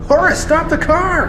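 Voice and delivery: panicked high shout, very loud; shouting at driver. Acted. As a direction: yell at him to stop the car. Panicked driver crashing the car.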